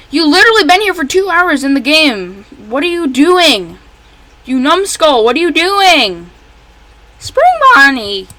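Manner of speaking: frustrated yell at a game character; exasperated scolding. Real, not acted. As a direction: scold the slacking bunny.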